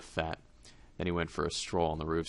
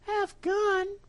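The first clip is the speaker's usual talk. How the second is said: very high, louder puzzled mouse; astonished mouse; 6 dB louder than usual. Acted. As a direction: echo the odd name amazed.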